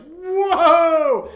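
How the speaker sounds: high, loud mock "whoa"; startled yell. Acting a foe who sees little old Jenny, startled yell. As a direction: cry out in exaggerated alarm on one long note.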